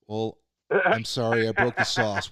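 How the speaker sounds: loud, slow, flustered excuse; flustered apology. Retold or imitated. Cook blurting an apology to the chef, loud and slow.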